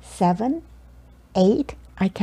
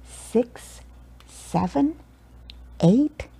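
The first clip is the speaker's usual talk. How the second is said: ordinary delivery, nothing marked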